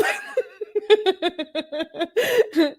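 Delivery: long, high-pitched giggling fit; real high peals of laughter; real laughing fit. Real, not acted. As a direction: crack up helplessly, pitch shooting up. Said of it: "I love your laugh".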